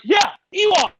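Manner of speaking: high, loud retold yes; mock-enthusiastic high shout; emphatic insistence. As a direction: shout the fib with conviction. Insisting on his cover story.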